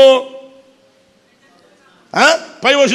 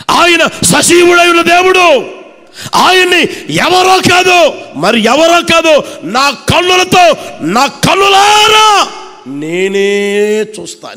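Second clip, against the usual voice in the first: loud, higher, slow proclamation; fiery preaching; 7 semitones higher, 3 dB louder, slower than usual. Acted. Preacher declaring Job's hope to the congregation, fiery. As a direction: preach it out with full force.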